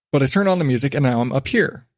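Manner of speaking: ordinary talk, nothing marked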